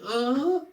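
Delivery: short mock moan, pitched high; imitated moan. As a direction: fake a small high moan. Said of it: "she's going".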